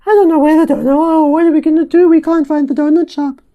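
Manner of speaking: father and son playfully overacting a desperate donut hunt; mock dramatic panic. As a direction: ham up a frantic search for a donut shop. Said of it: "pretending to be dramatic".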